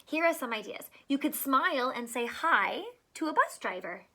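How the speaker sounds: lively picture-book read-aloud; reading to children. Acted. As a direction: read it out to a class of small kids. Reads picture book to kids.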